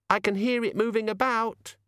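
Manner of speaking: put-on high child's voice; small child reporting a monster to a grown-up; high-pitched little girl; plaintive little girl. A narrator's reading.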